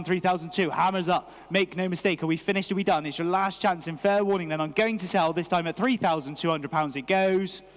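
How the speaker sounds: normal talking voice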